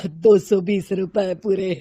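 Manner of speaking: slow, humble seller's voice; a poor hawker answering politely; vegetable seller. Acted.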